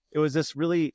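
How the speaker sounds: ordinary delivery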